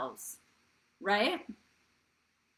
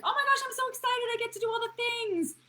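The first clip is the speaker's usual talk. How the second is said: put-on much higher, fast, childlike squeal; excited inner child; 14 semitones higher, 3 dB louder than usual. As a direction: play a giddy little kid. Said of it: "the child being like".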